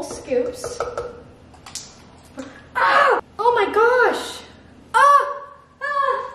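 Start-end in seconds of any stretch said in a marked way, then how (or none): 2.7-6.3 sharp high yelps of sudden pain. Real, not acted. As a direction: cry out as the finger gets cut. Just sliced finger on foil.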